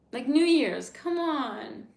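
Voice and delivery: loud, high exasperated plea. Real, not acted. Streamer exasperated about her sad New Year's. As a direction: throw up your hands at it.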